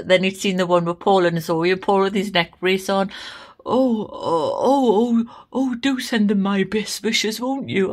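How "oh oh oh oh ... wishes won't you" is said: mum's posh king impression, slow and higher; hammed-up royal sympathy, drawn out; imitating the king. Mum retelling the king's reply to her, posh and slow. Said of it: "he giggled and he laughed".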